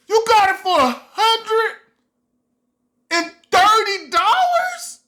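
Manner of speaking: high, loud, disbelieving question; amazed disbelief. Retold or imitated. Reading a huge phone discount, high amazed disbelief. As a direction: ring out in astonished glee. Can't believe the phone price.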